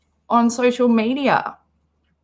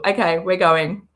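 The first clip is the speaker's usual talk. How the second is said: ordinary talk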